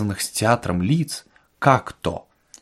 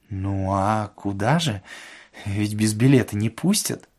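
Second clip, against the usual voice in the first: meek protest; 2 semitones lower, 5 dB quieter than usual. A narrator's reading. Воробьянинов answering Ostap's scolding, meekly puzzled.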